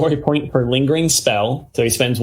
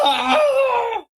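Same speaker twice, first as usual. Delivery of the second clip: high, drawn-out wail of pain; anguished scream. Acted. Burning Joan heard through the door, anguished scream. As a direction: cry out in agony, very high. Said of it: "when he hears Joan's anguish".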